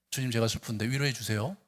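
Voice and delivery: mock prim, low and quiet request; flat simple prayer. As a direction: say it neatly, with no feeling. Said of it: "너무나 심플해". (담담하고 밋밋한 기도 흉내)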